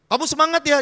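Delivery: seruan lantang bernada tinggi; pemimpin memberi semangat. Acted. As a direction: semangati bawahan dengan lantang. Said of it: "seorang leader bilang". (suara atasan menyemangati, keras dan tinggi)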